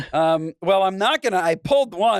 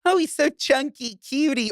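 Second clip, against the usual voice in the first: cooing chunky; 8 semitones higher than usual. Retold or imitated. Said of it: "If she was saying".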